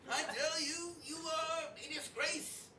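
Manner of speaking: high, fussy French chef voice. Acted. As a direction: sneer at guests like a haughty chef. GM voices Archibald scolding guests.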